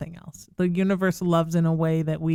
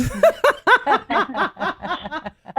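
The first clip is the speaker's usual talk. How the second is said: high bright laugh bursting out; laughing at her own joke about making Rebecca carry the bags; big burst of laughter; 11 semitones higher, 5 dB louder than usual. Real, not acted.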